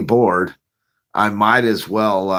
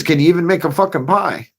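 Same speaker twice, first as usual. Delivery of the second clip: higher, taunting boss question; mock boss challenge; 6 semitones higher than usual. Boss doubting the applicant, mock taunt. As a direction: needle him like the pizza boss. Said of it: "he says".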